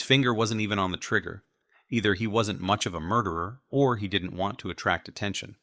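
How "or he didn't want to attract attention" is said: plain reading voice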